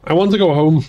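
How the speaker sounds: put-on child's whine. A narrator's reading. Becky asking her brother to take her home, whining. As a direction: plead like a little sister who's had enough. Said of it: "Becky's voice raised to a whine".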